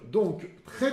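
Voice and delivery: ordinary talk, nothing marked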